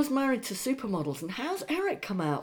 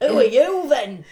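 loud, pitched-up put-on challenge; hammed-up brush-off to a stranger at the bar; mock Ivanka; 4 semitones higher, 11 dB louder than usual.

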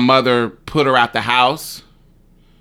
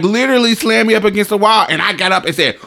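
ordinary talk

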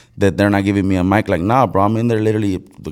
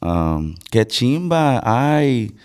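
paisa Colombian Spanish, proper and sing-song; lightly done Medellín paisa accent; 3 semitones higher than usual. Shown as a demonstration. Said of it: "Just go Colombian first".

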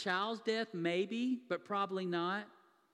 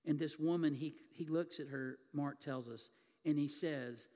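usual speaking voice

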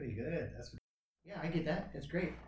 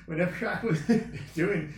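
laughing; 5 semitones higher, 7 dB louder than usual. Real, not acted. Laughing while telling.

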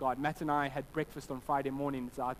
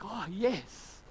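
acted burst of fatherly pride, higher pitched; proud delight; 5 semitones higher than usual. Dad hearing of his son's good behavior, proud delight. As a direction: let out a pleased, proud cheer. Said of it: "And I feel like".

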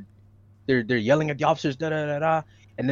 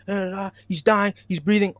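higher, pleading crowd voices, mildly raised; imitating bystanders yelling; 5 semitones higher than usual. Describing bystanders in the video, pitched-up pleading. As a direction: echo the bystanders' pleas, pitched up. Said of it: "voices that you could hear saying".

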